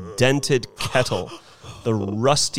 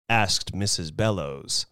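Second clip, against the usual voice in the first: usual speaking voice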